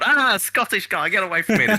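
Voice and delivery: high-pitched, hammed-up frightened cry; mock panic. Linesman fending off a big Scottish player, in mock panic. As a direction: squeal it like you're fleeing in comic terror.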